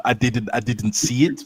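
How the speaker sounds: Wenger. Retold or imitated. Fan joking he didn't see the fight, light manager voice. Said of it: "I'm doing a Wenger right now".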